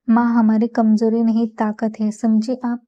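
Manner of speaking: usual speaking voice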